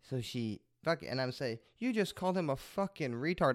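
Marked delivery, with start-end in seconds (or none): 1.8-3.5 higher-pitched indignant retelling, not shouted; losing his cool. Retold or imitated. Retelling his outburst at the coffee-shop lady, mock losing his cool. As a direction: reenact your outrage with raised pitch. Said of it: "I lost my cool".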